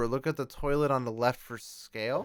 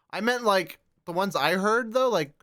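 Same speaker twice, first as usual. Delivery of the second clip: talking through hiccups; 6 semitones higher, 3 dB louder than usual. Real, not acted. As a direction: hiccup breaking into the words. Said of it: "I got the hiccups".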